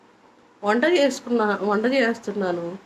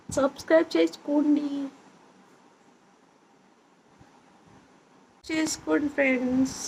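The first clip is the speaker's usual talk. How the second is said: put-on coaxing baby talk; 4 semitones higher, 5 dB quieter than usual. Shown as a demonstration. Mother prompting baby Chikki to say subscribe, coaxing baby talk. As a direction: model the words gently in a higher voice for a baby.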